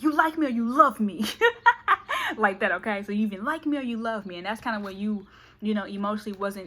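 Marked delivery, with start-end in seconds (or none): 0.0-1.2 a hint of a cocky suitor; loud pushy ultimatum; man's demand. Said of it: "You're like". 1.3-2.3 loud high giggle; high laugh. Real, not acted. Laughs at own joke.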